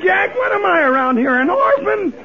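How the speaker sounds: usual speaking voice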